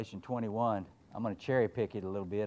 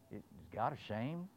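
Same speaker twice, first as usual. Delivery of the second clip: usual speaking voice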